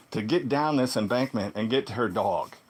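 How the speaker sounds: ordinary talk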